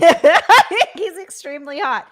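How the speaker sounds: loud, high, through a burst of laughter; laughing. Real, not acted. Guest admiring a photo to the host, laughing. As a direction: blurt it out mid-laugh, drawing it out.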